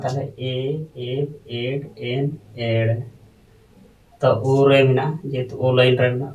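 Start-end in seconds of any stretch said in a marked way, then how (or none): 0.0-2.9 teacher spelling out letters; reading letters aloud. Shown as a demonstration. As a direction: sound out each Ol Chiki letter name. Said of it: "ᱟᱫᱚ ᱮ line ᱨᱮ ᱢᱮᱱᱟᱜᱼᱟ".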